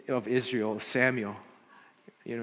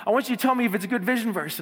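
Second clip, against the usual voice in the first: put-on eager girl; 7 semitones higher, 4 dB louder, faster than usual. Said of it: "and she goes".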